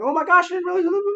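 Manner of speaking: high, loud mock-excited gushing; amazed outburst. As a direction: squeal it in hammed-up amazement. Acts out own amazed reaction.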